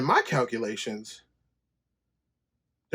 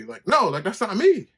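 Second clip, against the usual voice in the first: worked up; 8 semitones higher, 5 dB louder than usual. Retold or imitated. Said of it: "I'm like".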